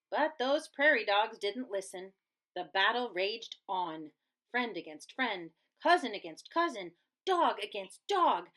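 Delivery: ordinary delivery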